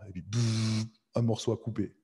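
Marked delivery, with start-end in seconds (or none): 0.2-0.9 imitated cutting buzz. Preacher mimes cutting out a piece, imitated buzz. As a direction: buzz through the lips.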